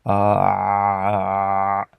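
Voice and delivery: "A" pressed from the Adam's apple, held; throat ain. Shown as a demonstration. Teacher showing how to make the ain, tight throat. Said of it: "en sortant le son A".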